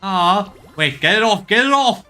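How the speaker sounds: high, loud, rising panic; panicked yelp. Real, not acted. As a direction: squeal to get it off you. Creature climbs onto him in game.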